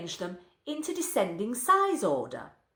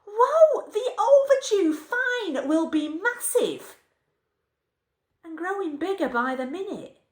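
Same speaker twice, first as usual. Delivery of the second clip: much higher and louder, a sudden jolt of alarm; shocked whoa; 9 semitones higher, 5 dB louder than usual. Acted. Reader of a comic poem realizing the library fine is huge, shocked. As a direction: blurt it out as the fine sinks in.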